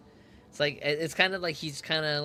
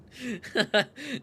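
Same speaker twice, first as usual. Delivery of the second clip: loud laugh; 10 semitones higher, 5 dB louder than usual. Real, not acted. Laughing at game dialogue.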